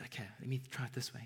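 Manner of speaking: quick hushed whisper of Jesus. Retold or imitated. Jesus asking Peter again, in a quick whisper. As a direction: breathe it out fast, voiceless. Jesus voiced, retelling scripture.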